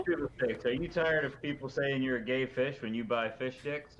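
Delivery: ad announcer. Pretend. Mock commercial pitch.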